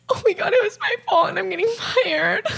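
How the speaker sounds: high, rushed fake-crying squeal; hammed-up panicky whimper, played for laughs; mock tearful panic. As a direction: imitate yourself melting down in front of a boss. Retells panicking at boss moving her.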